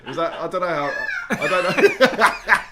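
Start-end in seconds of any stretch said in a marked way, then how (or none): 1.3-2.7 laughing. Real, not acted. Laughing after puppy remark.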